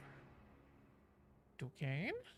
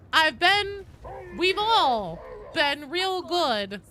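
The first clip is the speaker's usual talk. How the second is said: playful pleading; 8 semitones higher, 7 dB louder, much slower than usual. Pretend. Pleading like child to Santa.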